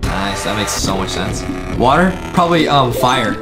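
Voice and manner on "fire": usual speaking voice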